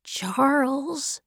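soft, careful address; hushed appeal to a friend; quietly. A narrator's reading. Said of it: "He was talking to him quietly".

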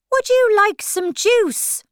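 high, loud, cheerful March Hare; happy. A narrator's reading. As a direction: offer it happily in a high character voice. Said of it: "asked Alice happily".

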